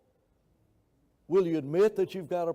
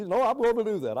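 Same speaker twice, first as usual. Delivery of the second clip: louder, higher cocky retort, imitated; stubborn insistence; 8 semitones higher, 7 dB louder than usual. Retelling a man overruling Bill's advice, stubbornly insistent. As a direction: play the fellow brushing off caution. Acted argument over gasoline in carburetor.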